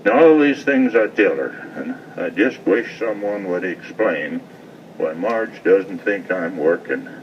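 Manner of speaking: rhythmic cowboy poem reading; poem recitation. Acted.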